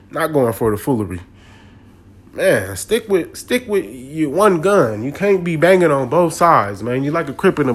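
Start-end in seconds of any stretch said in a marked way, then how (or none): none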